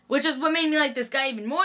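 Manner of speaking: usual speaking voice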